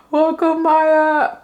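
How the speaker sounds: high, loud, thrilled readout; excited reading. Real, not acted. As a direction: read the greeting like a big reveal. Reading welcome screen excitedly.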